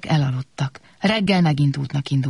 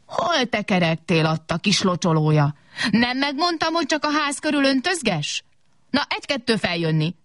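scolding; 6 semitones higher than usual. Acted. Big can scolds little one.

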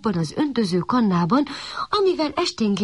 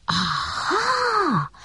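realising aha; 11 semitones higher than usual. A narrator's reading. Puppy realises something.

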